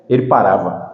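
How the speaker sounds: usual speaking voice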